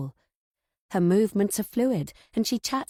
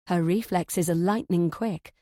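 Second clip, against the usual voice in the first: plain reading voice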